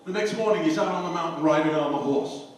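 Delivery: usual speaking voice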